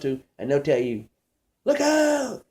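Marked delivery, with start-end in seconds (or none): 1.6-2.4 sudden high yelp of warning; mock yell. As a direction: yelp it like a panicked passenger. Passenger yelling warning.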